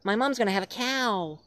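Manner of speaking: put-on high frightened girl voice; put-on scared teen girl begging; terrified girlfriend. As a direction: plead with him to leave. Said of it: "I'm just so terrified".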